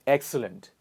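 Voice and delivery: ordinary delivery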